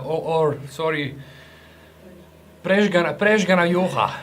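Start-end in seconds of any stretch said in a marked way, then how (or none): 2.6-4.1 loud, higher Zagorje accent; original Zagorje accent. Shown as a demonstration. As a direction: punch it out in a thick Zagorje accent. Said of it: "This was my, uh, original, original accent".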